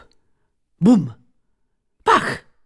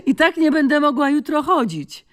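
laughing through the words, higher; 10 semitones higher than usual. Acted. Exhausted Joanna laughs about tomorrow's sore legs, laughing.

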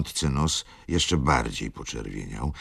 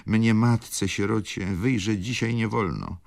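grieving baroness, pitched higher; baroness lament; 10 semitones higher than usual. Acted. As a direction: plead as a wronged, grieving mother.

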